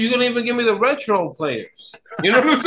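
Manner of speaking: usual speaking voice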